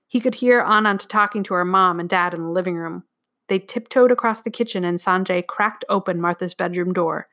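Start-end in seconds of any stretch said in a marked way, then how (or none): none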